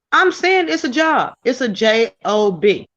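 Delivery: ordinary talk, nothing marked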